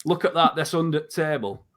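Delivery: mild Yorkshire voice dropping the article before "table"; a light Yorkshire "It's under table"; Yorkshire accent. Shown as a demonstration. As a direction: say "under table" like a Yorkshire local pointing something out. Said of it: "They'll say".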